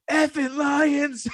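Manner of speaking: very high, drawn-out mock yell; imitated fan cursing. Bar fan after Lions shutout, imitated exasperated cry. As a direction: mimic an exasperated fan cursing his team.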